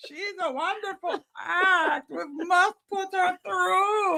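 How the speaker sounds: put-on very high, slow Colombian accent; hammed-up Colombian squeal, like on helium; Sofia Vergara. Talent-show judge praising an act, high mock Colombian voice. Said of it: "That's what she sounds like".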